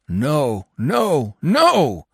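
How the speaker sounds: high, rising refusal; raging. A narrator's reading. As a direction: rage in mounting yelps. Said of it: "the dog raged".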